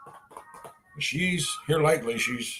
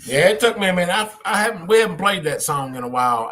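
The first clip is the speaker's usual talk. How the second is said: ordinary talk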